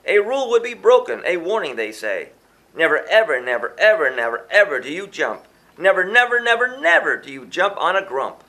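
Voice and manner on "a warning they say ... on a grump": ordinary delivery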